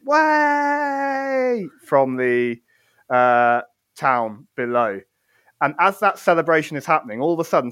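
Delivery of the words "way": long high-pitched cheer; crowd cheer. Acted. Game master as townsfolk cheering from below, long high whoop. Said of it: "And you just hear this".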